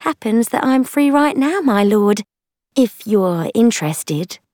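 high, inviting female character voice; woman companion's voice. A narrator's reading. Woman propositions the lord, high inviting female voice. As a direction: pitch it up and offer yourself to the lord.

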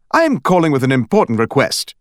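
lightly British, formal caller; a hint of a British detective; British accent. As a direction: state the request in a faint British accent. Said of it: "It also had an accent".

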